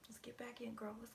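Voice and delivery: soft whisper to herself; whispered pep talk. Real, not acted. Psychic whispers encouragement to herself. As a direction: hype yourself up quietly.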